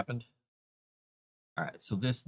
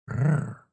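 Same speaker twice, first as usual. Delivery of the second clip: playful growl; 5 semitones higher, 6 dB louder than usual. Pretend.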